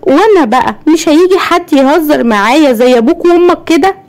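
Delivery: put-on high-pitched childish voice; طريقة طفولية. A narrator's reading. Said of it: "وقالت بصوت واطي وبطريقة طفولية". (بطريقة طفولية وصوت رفيع)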